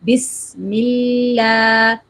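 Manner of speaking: very slow "بسم اللہ", hissed s and long light la; stretched tasmiyah. Shown as a demonstration. Teacher puts it together, drawn-out "بسم اللہ". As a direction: recite "بسم اللہ" with the last vowel held up. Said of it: "آواز کو بلند کرنا ہے".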